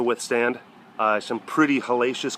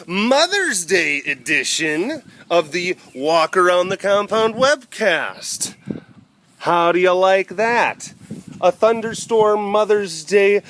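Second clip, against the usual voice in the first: high, slow, showy announcer voice; hammed-up host intro; 7 semitones higher, 3 dB louder than usual. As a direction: open the webcast like a grand show. Mock show intro.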